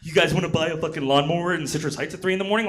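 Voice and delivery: quick, hustling seller's pitch in a put-on voice; mock late-night sales pitch. Himself posting a late-night sale.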